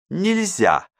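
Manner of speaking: high, curt «Нельзя»; flat refusal. A narrator's reading. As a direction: cut her off with a firm «Нельзя». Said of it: "возразила Марта". (резкий отказ: «Нельзя»)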